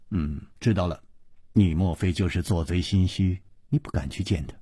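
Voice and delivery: quiet, low taunt; cold sneer. A narrator's reading. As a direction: goad coolly with understated scorn, voice dropping. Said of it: "忽然冷笑着说". (低声冷笑着激将)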